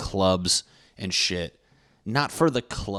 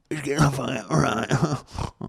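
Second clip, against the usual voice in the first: drawn-out sing-song repeat, higher, slow; sing-song correction; 6 semitones higher, much slower than usual. Real, not acted. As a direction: stretch it out teasingly, higher.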